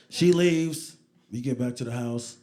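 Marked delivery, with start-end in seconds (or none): none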